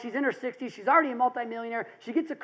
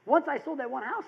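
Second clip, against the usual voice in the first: ordinary talk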